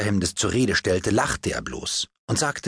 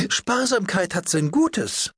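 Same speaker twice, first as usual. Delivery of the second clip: gracious duchess; 11 semitones higher, slower than usual. Acted. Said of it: "bemerkte die Herzogin gnädig".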